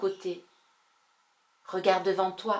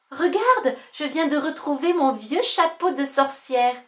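loud, higher delighted find; excited; 6 semitones higher, 8 dB louder than usual. Acted. Girl finding her witch hat, excited. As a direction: show off the find.

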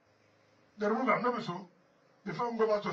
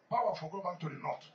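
usual speaking voice

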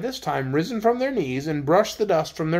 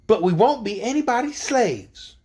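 put-on mildly defiant, higher-pitched girl; positive refusal; 5 semitones higher than usual. A narrator's reading. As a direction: refuse firmly, pitched a little high. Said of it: "replied Betsy positively".

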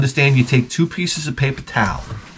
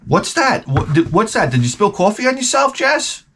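mocking coworker; 8 semitones higher than usual. Coworker mocks the pee stain.

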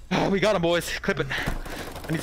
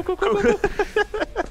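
squeaky cool; 12 semitones higher, much slower than usual. Real, not acted. Brushing off breathlessness.

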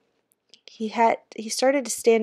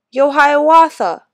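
louder, higher, slow taunting yell; 7 semitones higher, 11 dB louder than usual. A narrator's reading. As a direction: call across the yard mockingly. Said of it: "someone yelled".

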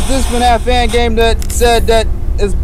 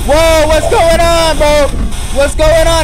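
higher-pitched replay of a startled reaction; 7 semitones higher, slower than usual. Retold or imitated. Gamer retelling a reaction to a game, startled. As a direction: act out being caught off guard, pitch up.

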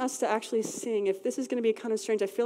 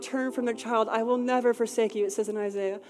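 louder and higher, preaching God's promise; fervent prophetic declaration; 3 semitones higher, 5 dB louder than usual. Acted. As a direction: proclaim it with rising conviction. Prophetic declaration at full force.

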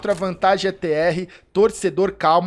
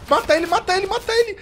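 high, urgent cheer, yelled at the screen; willing the player to get the kill; excited shouting; 13 semitones higher, faster than usual. Real, not acted. Watching a live match duel.

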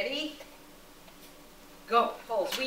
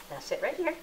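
ordinary delivery

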